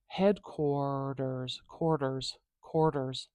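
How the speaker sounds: "Headquarters" stretched very slowly on the or; drawn-out demonstration. Shown as a demonstration. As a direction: sound out "Headquarters" slowly, lingering on quar. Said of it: "let's go back here".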